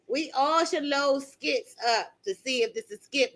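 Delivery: ordinary delivery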